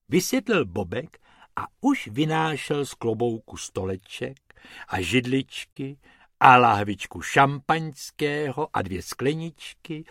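plain reading voice

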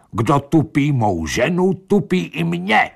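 high-pitched, acted yell; outraged shout. A narrator's reading. Pitra shouting in defence of his wife, outraged. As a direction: cry it out high in indignation. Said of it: "Pitra křičel".

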